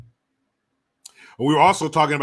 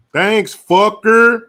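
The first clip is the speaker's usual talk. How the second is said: loud, high, drawn-out holler; loud mock thanks; 9 semitones higher, 13 dB louder, much slower than usual. Streamer thanking a viewer, loud drawn-out holler. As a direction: stretch it out and shout it.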